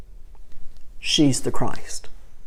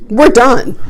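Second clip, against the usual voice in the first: exasperated retort; 9 semitones higher, 11 dB louder than usual. Retold or imitated. Retold argument with husband.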